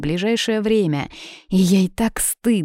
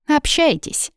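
high, pointed announcement for both men; deliberately loud; 10 semitones higher, slower than usual. A narrator's reading. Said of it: "нарочно громко произнесла для обоих".

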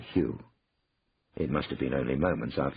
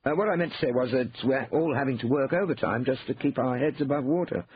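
higher-pitched backtracking brother; hasty self-justifying explanation; Hugh, flustered; 8 semitones higher than usual. A narrator's reading. Brother covers his slip, flustered backtracking.

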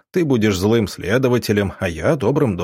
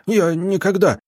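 high, choked denial; breathless protest; 9 semitones higher than usual. Acted. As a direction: gasp the words out in panic. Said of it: "Федя аж задыхаться стал".

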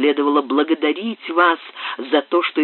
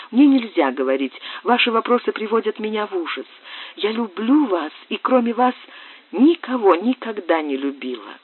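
взволнованная девушка; 7 semitones higher than usual. Acted. Сюзанна в ужасе признаётся.

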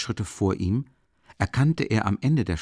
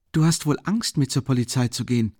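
mocking; 6 semitones higher than usual. A narrator's reading. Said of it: "fragte Raskolnikow spöttisch".

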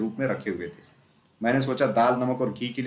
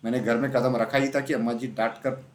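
plain reading voice

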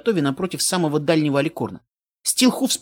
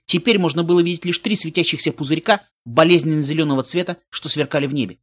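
ordinary delivery

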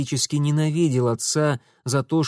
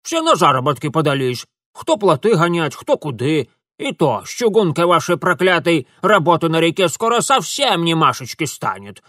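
louder, higher dialect-speaking granny; feisty old village woman, grumbling then proud; 4 semitones higher, 6 dB louder than usual. Acted. Old woman complains to Mitya about vanished work, loud grumbling. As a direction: grumble loudly like a country grandmother.

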